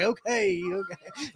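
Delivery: softly voiced, trailing-off dad reply; doubtful dad. Dad humouring his pumped-up son, quiet and doubtful. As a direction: let the second word fade almost away. Dad's hesitant reply, retold.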